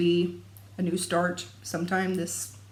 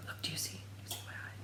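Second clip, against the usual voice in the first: hushed whisper to the camera; 19 dB quieter, much faster than usual. Real, not acted. Pointing out her twitching eye to viewers, whispering. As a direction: lean in and whisper it.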